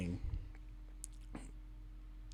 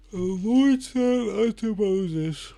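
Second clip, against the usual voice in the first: higher, slow, stretched by a yawn; 7 semitones higher, 5 dB louder, much slower than usual. Real, not acted. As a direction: read it through a big yawn. Line read mid-yawn.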